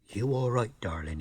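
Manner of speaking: low, soft crooning darling; a hammed-up lady-killer, low and hushed; seductive charmer. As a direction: coax her like a beach Romeo. Husky chap woos her.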